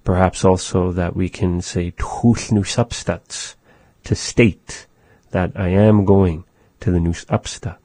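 ordinary talk, nothing marked

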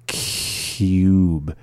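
"cube" with a long breath of hiss after the c; slow, held onset. Shown as a demonstration. Teacher showing learners the sound, "cube" slowly with its first sound held. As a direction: draw out the start of "cube" very slowly. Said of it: "holding that first sound".

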